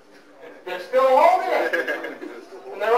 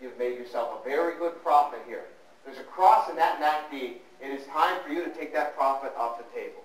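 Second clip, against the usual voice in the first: low, soft mock-serious advisor; advisor counselling client; 8 semitones lower, 4 dB quieter than usual. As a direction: lay it out quietly and soberly. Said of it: "So now I say".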